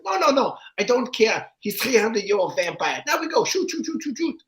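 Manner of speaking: put-on very high, insistent German-accented orders; German director impression. Director rejecting writers' pitches, high German accent. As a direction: bark it like an impatient German director. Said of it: "the other thing is like".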